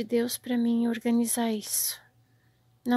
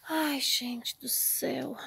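slow unvoiced whisper of dismay; despairing whisper; 5 dB quieter, slower than usual. Real, not acted. To viewers over the wrecked shelves, a slow despairing whisper. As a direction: sigh it out, defeated.

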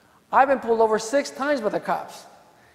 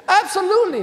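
emphatic, high and loud; eager loud yes; 9 semitones higher, 6 dB louder than usual. Retold or imitated. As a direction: blurt it out eagerly. Prosecutor eager to retry case.

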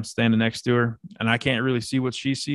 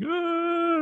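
very high drawn-out mock wail; imitated crying wail. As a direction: imitate a terrified child's high cry. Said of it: "She started crying and".

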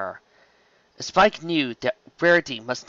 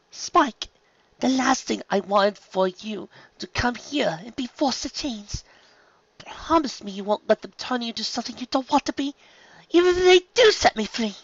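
high-pitched character voice, urgent plea; pleading Rarity; 11 semitones higher than usual. A narrator's reading. Unicorn begging Spike for a promise, pleading. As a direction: beg in a much higher voice.

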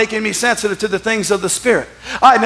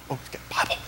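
hushed onlookers' whisper; secretive, barely voiced gossip; mock whisper; 11 semitones lower, 9 dB quieter, faster than usual. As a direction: whisper it like a scandalized bystander. Imitates people murmuring about the Bible.

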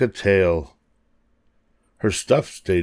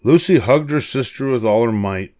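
plain reading voice

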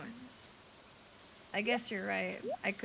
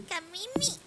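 high, drawn-out calling of Kamimi with a falling end; 13 semitones higher, 4 dB louder than usual. Pretend. Calling out a name repeatedly.

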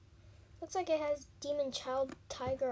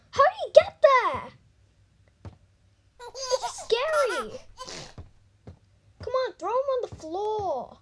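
high, loud frightened shriek; startled scream; 10 semitones higher, 9 dB louder than usual. Real, not acted. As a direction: yelp in real fright at the screen. Startled by the baby in the horror game.

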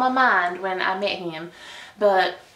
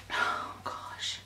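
whispered, cringing oh gosh; mortified whisper; embarrassed whisper; 10 dB quieter than usual. Real, not acted. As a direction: breathe it out mortified. Recalling embarrassment.

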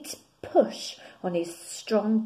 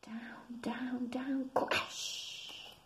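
much softer, unvoiced; hushed whisper; 4 semitones higher, 9 dB quieter, much slower than usual. A narrator's reading. Reader whispers the tumble to listeners. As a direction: whisper the fall to the children.

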